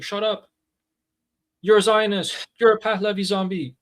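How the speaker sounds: high, sneering mock of a regime hardliner; mocking hardliner insult. Host parodying regime factions insulting each other, high and sneering. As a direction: parody the hardliners' name-calling.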